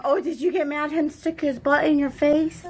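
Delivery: usual speaking voice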